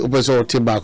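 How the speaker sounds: normal talking voice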